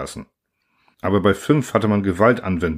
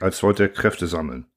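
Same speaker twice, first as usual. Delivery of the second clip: usual speaking voice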